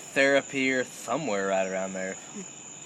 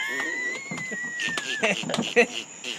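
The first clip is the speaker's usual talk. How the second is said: shrieking laugh; 5 dB quieter than usual. Real, not acted. Big laugh after punchline.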